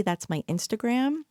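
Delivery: ordinary talk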